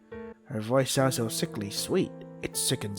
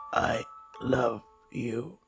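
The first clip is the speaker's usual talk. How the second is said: very slow, soft croak; 4 dB quieter, much slower than usual. Acted. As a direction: croak it out slowly and quietly. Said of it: "I croaked out the three words".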